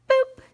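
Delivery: a very high, loud "boop"; playful boop sound effect; boop noise. Shown as a demonstration. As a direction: squeak out a bright boop. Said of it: "Make that noise".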